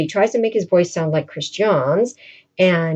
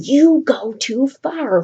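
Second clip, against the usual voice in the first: slow, higher mock rebuke; mock hissed reproach; scolding aside; 5 semitones higher, much slower than usual. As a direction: hold him back with a stern aside.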